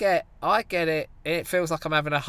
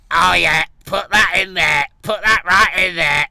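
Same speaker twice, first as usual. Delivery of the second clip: loud, hammed-up Mansfield accent; 8 dB louder than usual. Playing a crude Mansfield bloke at a sex party, loud Mansfield accent. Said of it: "your old mate from Mansfield".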